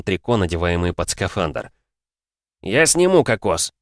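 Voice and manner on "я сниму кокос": contemptuous. Acted. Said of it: "презрительно пообещал Голубев".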